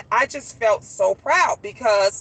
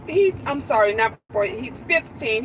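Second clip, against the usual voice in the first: ordinary delivery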